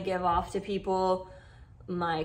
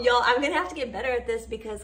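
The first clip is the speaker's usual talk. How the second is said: usual speaking voice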